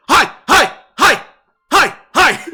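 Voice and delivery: high, loud yapped greetings; excited talking-dog bark. Acted. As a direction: bark each word out as a separate yip. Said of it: "they start barking".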